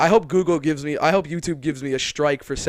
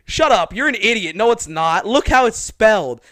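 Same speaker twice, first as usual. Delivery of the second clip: higher and louder, fed-up telling-off; mock exasperated yell; 6 semitones higher, 8 dB louder than usual. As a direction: shout her down in an argument. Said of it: "she was like".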